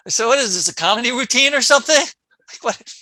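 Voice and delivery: loud, high, quick comic outburst; mock indignant retort. Awake patient to surgeon who said oops, mock indignant. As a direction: call it out in joking disbelief.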